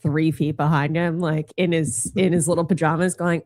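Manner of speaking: ordinary delivery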